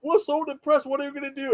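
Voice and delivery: lightly hammed despair; gloomy characters. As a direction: whine it like a sulking crowd. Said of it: "everybody's like".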